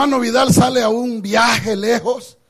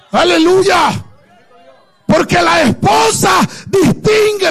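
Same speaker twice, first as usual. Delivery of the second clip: preaching; 2 semitones higher than usual. Acted. Preacher proclaiming to his church, full force. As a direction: stir up the congregation.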